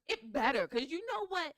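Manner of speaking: loud, high, indignant burst; mock-indignant outburst; loud mock outrage. Real, not acted. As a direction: play up the outrage. Indignant about flying cars.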